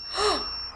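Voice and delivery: quick, high, quiet gasp; surprised gasp. Real, not acted. Vlogger watching a music video, surprised gasp. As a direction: suck in a small startled breath. Reacts to what is on screen.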